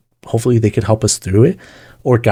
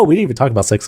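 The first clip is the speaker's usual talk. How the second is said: usual speaking voice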